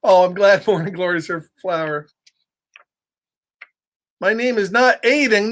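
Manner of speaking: ordinary delivery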